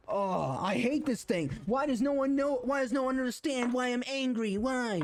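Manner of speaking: mock frustrated rant. Describing how he used to sound when angry, in a mock frustrated whine. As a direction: act out your old angry self, exasperated. Acting out his past angry self.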